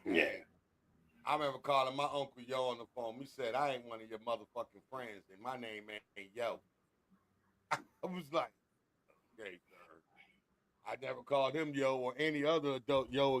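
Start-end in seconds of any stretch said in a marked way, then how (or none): 3.5-6.6 low, quiet put-down, lightly acted; a restrained, retold telling-off; uncle's stern rebuke. Uncle correcting nephew for saying yo, quietly stern. 9.4-10.0 very quiet, low and chastened; meek compliance. Retold or imitated. Nephew answering his uncle's rebuke, meek and hushed.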